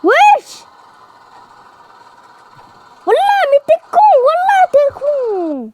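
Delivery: high-pitched, incredulous wailing reproach; exasperated outcry. Real, not acted. Player berating a friend after the lost game, exasperated outcry. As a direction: berate them, stretching the last words.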